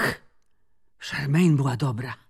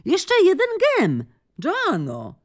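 calling out; 13 semitones higher, slower than usual. Acted. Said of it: "zawołała Charmaine".

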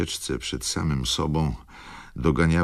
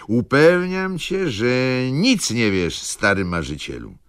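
loud insistence; 9 semitones higher, 5 dB louder than usual. A narrator's reading. Wokulski laughing, tipsy, insisting.

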